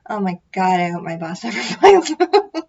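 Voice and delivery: loud, breaking into laughter; laughing. Real, not acted. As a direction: laugh through the words. Joking about boss finding out.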